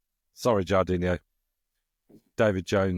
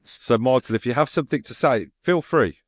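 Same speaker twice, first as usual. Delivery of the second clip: louder and higher, playfully pleading; mock-frustrated public plea; exasperated appeal; 5 semitones higher, 5 dB louder than usual. Real, not acted. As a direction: call him out, building in pitch and volume. Mock appeal to a silent typer.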